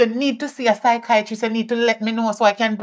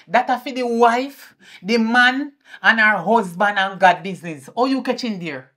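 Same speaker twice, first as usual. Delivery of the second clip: ordinary talk, nothing marked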